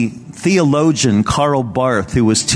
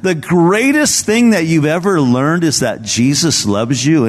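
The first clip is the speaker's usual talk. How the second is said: higher-pitched mild mock disbelief; scoffing student; 6 semitones higher than usual. Student challenging Karl Barth's answer, mildly scoffing. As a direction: imitate a student protesting at the simple answer. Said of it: "And the student kinda scoffed".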